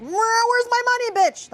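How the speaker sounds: high, drawn-out mock shout; hammed-up money-demanding holler; mock aggressive yell. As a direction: yell it like a playful shakedown, loud and high. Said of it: "just go like".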